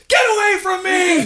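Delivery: high, loud drawn-out shout; shouted outburst. Retold or imitated. Retold drunken outburst at girlfriend.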